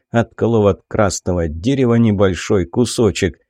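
plain reading voice

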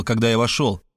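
ordinary delivery

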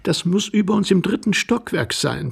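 high, anxious woman's voice; anxious young wife. A narrator's reading. Young wife blames the floor above, anxiously. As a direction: cover up nervously in a high voice. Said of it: "bemerkte die junge Frau in ihrer Herzensangst".

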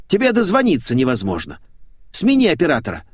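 grumbling complaint. A narrator's reading. Митяев complaining he cannot get through, grumbling. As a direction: grumble at the unreachable employee. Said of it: "буркнул Митяев".

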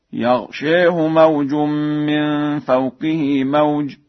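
slow chant, "يغشيه" with an e-tilted long vowel; taqlil. Shown as a demonstration. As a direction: chant it drawn out, yaghshèhu for "يغشيه". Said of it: "التقليل في ذوات الياء". (ترتيل مع تقليل "يغشيه")

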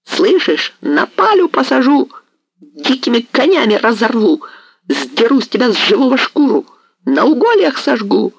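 a maddened hetman threatening torture; hoarse raging threats. A narrator's reading. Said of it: "хрипел он".

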